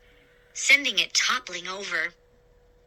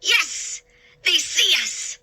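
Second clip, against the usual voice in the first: excited agreement; 7 semitones higher than usual. A narrator's reading. Second head agrees excitedly.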